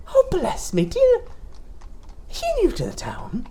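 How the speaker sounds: put-on high, sly old shopkeeper voice; sweet but sinister seller; sly mysterious shopkeeper. Toy seller.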